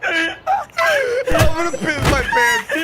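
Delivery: high, loud wheezing laugh; laughing hysterically. Real, not acted. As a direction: crack up until you can barely talk. Helpless laughter at a drawing.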